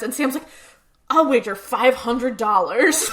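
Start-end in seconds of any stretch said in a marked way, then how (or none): 1.1-3.1 slow, loud, lightly acted drunken boast; drunk gambler. Drunk Sam betting at pool, mock slurring boast. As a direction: draw out the bet with mock tipsy bravado. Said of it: "drunk out of his mind".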